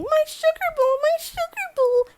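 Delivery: tiny, very high-pitched lament; teeny near-bawling voice. Acted. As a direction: wail it small, on the verge of tears. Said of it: "in this teeny voice, like she was on the verge of really bawling".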